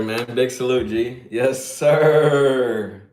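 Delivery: normal talking voice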